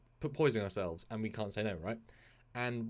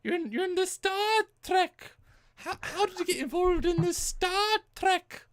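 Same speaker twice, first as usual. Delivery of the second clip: much higher, slow muddled Celtic puppet; slower than usual. Acted. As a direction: ask it like a nosy high-pitched puppet. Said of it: "Are you Irish now?".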